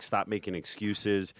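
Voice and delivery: usual speaking voice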